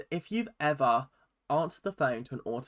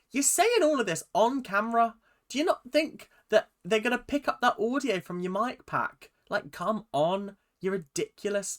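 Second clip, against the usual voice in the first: exasperated scolding; 8 semitones higher than usual. Retold or imitated. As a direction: come on bestie, sarcastic and fed up. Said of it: "I'm just like".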